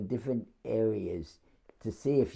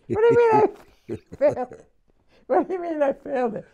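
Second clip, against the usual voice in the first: much higher, stunned and half-laughing; shocked disbelief; 5 dB louder, faster than usual. Retold or imitated. Recruit told he failed the test: very high, stunned disbelief. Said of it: "I almost fainted".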